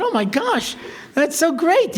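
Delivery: high-pitched mock delight; gushing. Rabbi greets a half-remembered former student, gushing in imitation. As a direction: gush as if you remember the caller. Feigns recognizing forgotten caller.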